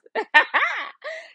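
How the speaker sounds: loud high cackle; high laugh. Real, not acted. Laughing after threatening Jenny, high cackle. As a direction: burst into a shrieking laugh. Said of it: "I'm laughing and shit".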